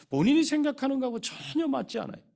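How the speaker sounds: fervent preaching emphasis; emphatic high burst. Real, not acted. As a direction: hit it with rising urgency.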